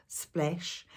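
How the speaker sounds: usual speaking voice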